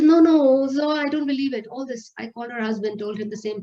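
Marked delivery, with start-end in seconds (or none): 0.0-1.7 retold frantic refusal, high and loud; distressed denial. Younger sister refuses to believe the news, distraught. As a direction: cry out in disbelief, imitating the sister.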